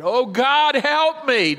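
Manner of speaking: high, loud plea; crying out. Acted. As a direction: cry out to God in misery. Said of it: "cried out to God".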